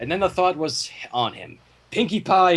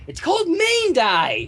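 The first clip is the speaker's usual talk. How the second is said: mocking shout; 11 semitones higher than usual. Mocking reply.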